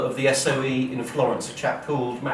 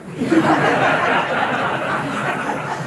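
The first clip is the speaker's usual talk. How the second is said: loud real laugh; 14 semitones higher, 4 dB louder than usual. Real, not acted. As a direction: burst of high, breathy laughter. Laughing at his own punchline.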